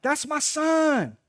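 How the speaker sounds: high, loud, stretched-out holler; proud scream. Retold or imitated. Dad screaming as his boy reaches first base, proud scream. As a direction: yell it proudly, drawing out the words. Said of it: "I'd be screaming like".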